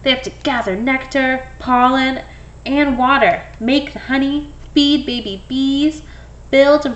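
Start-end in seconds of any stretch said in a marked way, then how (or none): none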